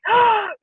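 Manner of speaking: sudden high gasp; sharp acted intake of breath; freaked-out gasp. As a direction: gasp as if struck by an idea. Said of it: "I was freaking out".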